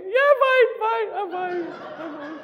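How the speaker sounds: very high, loud, laughter breaking through; laughing it off. Real, not acted. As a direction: insist you're fine while laughing through emotion. Choked up, laughing it off.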